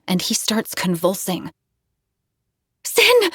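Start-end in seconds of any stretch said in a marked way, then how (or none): 2.8-3.3 high urgent call of his name; louder call. Acted. Narrator calls louder to convulsing Sin, high urgent shout. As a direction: call him louder to wake him. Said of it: "I call him more loudly".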